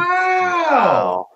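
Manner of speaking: a shouted, sky-high wow; stunned wow. Real, not acted. Friend hears Minnesota ranked first, shouts in disbelief. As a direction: react in total disbelief. Reacts to Minnesota top pick.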